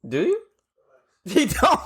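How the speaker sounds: ordinary delivery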